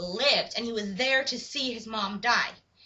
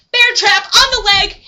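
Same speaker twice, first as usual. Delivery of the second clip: loud, high, drawn-out exclamation; excited blow-by-blow yell; dramatic storytelling shout; 7 semitones higher, 14 dB louder, slower than usual. Retold or imitated. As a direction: hammer out the gory shock. Dramatic shout of sudden injury.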